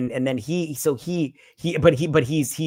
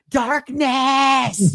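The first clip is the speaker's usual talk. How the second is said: high, loud imitated bellow; mock heroic shout; 12 semitones higher, 6 dB louder than usual. Retelling the hero calling out to Darkness, mock heroic shout. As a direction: holler the name, pitched way up.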